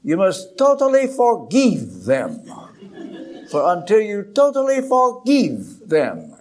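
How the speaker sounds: put-on slow, weighty Romanian-accented charge; imitating a Romanian friend's accent. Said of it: "in his Romanian accent".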